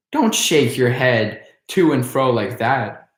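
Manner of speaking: ordinary delivery, nothing marked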